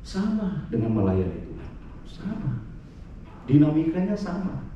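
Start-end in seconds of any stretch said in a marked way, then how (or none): none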